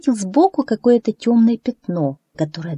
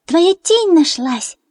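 joyful; 11 semitones higher, 4 dB louder, slower than usual. A narrator's reading. Friends cheer the duckling.

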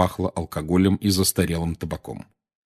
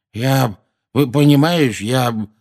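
uneasy stammer; 5 semitones higher, 5 dB louder, slower than usual. A narrator's reading. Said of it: "Наземникусу было чрезвычайно не по себе".

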